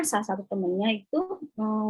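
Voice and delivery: ordinary talk, nothing marked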